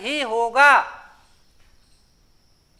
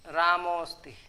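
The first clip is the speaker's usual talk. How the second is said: soft repeat; 6 semitones lower, 9 dB quieter than usual. Shown as a demonstration.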